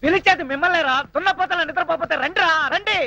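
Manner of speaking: high, panicky calling out; frantic yelling. Acted. Man begging Gangaram to stop, frantic yelling. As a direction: cry out like a yelping animal. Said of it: "తోక పీకల కూతులా అరుస్తున్నావు".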